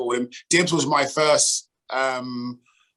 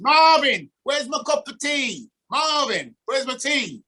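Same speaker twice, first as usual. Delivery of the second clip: much higher, hammed-up imitation of a teammate demanding his tea; imitating Dibs; 11 semitones higher, slower than usual. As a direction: play up the old pro calling for his tea in a high voice. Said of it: "And every morning it was".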